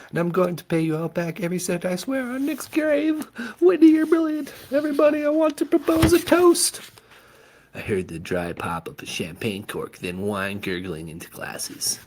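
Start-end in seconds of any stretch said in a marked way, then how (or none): 0.1-6.8 very high, loud excited toast; giddy toast. A narrator's reading. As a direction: squeal out the toast. Mom giddy toasting friends.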